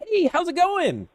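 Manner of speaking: very high, slow, beaming hello; super upbeat. Acted. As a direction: greet them with big energy. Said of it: "We're gonna be super".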